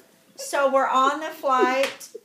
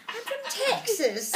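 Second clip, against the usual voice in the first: high put-on English accent; mimicked English accent; 8 semitones higher than usual. Admitting to the English lady she's Texan, high English accent. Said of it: "we're talking like this the whole time".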